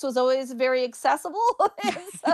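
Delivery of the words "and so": laughing through the words, much higher. Real, not acted. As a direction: giggles while starting the sentence. Laughs at her own joke.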